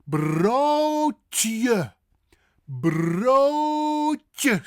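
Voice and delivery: high, loud broodje, long oh and crisp tje; teacher's drawn-out broodje; exaggerated Dutch pronunciation. Shown as a demonstration. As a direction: stretch broodje for repeating. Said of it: "Say it with me".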